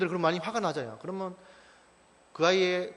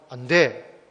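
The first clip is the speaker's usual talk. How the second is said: put-on firm, loud refusal to a toddler; stern no; 2 semitones lower, 8 dB louder, slower than usual. Father refusing his crying toddler, stern and loud. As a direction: shut it down firmly. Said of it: "아주 엄하게".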